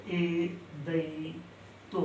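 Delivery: एदैतो stretched very slowly and softly; slow, soft drawn-out recital. Shown as a demonstration. Teacher to students, easing the sutra word out very slowly and softly. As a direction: let एदैतो trail out slowly. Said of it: "सातवां है".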